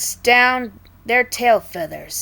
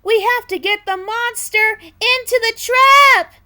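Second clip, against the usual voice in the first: high, loud urgent call; rally them fast and loud; urgent shout; 5 dB louder than usual. Acted. Bird urging the others toward the trap, high urgent shout.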